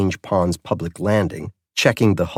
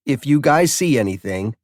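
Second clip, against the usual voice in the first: put-on higher, cocky young voice; a know-it-all kid talking back; lightly voiced teenage boy; sweaty teen boy; 6 semitones higher, 4 dB louder than usual.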